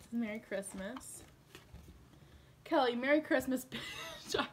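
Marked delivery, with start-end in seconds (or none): 3.7-4.5 laughing. Real, not acted. Laughing reading the card.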